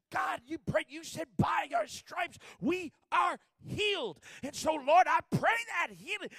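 loud, slow preaching shout; fired-up preaching. Retold or imitated. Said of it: "he got in his preaching self".

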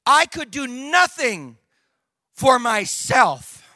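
very slow, emphatic, with a pause before "for myself"; driving the point home. Real, not acted. Preacher driving his point this morning, slow emphatic delivery. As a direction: hammer "nothing" and "myself" slowly and firmly. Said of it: "the point I'm trying to drive here".

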